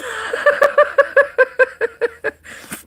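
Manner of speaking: long high giggle fit; high laugh. Real, not acted. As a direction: burst into giggles. Laughing at her hiccups.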